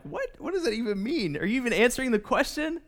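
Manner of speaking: mock bewildered questioning; baffled. Follower puzzled by Jesus' cryptic sentence, acted bafflement. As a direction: play a puzzled, incredulous follower.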